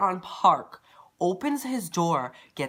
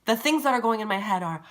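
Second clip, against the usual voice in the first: ordinary talk, nothing marked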